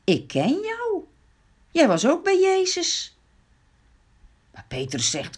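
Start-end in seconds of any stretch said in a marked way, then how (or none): 0.0-3.2 put-on young maid, high and accusing; servant girl accusing. A narrator's reading. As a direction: point her out: I know you. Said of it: "ze zegt".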